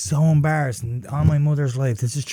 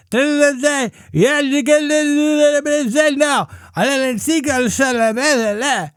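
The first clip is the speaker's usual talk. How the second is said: high babbling mock of her garbled page; garbled high-pitched nonsense mimicry; deaf accent; 11 semitones higher, 3 dB louder than usual. Comedian imitating the cashier's unintelligible overhead page, high babble. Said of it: "she had deaf accent".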